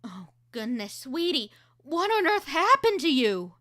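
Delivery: worried mom. Shown as a demonstration. Concerned mother in reel.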